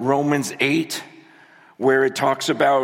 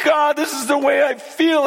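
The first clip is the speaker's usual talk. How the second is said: fervent prayer; 11 semitones higher than usual. Retold or imitated. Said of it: "I'm, I'm like".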